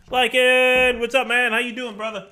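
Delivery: loud, high, happy call to a friend; excited greeting. Real, not acted. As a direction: hail your buddy across the yard.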